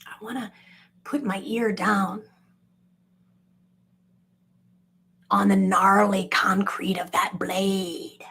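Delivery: whisper. Acted. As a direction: breathe it like a secret. Whispered fascination.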